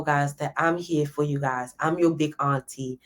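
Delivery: usual speaking voice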